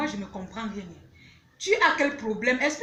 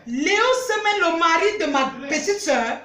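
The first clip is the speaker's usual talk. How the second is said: high, loud disbelieving exclamation; stunned, raised-voice repeat of bad news; shocked outcry; 5 semitones higher, 5 dB louder than usual. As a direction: cry out the words in loud shock. Shocked at sister's confession.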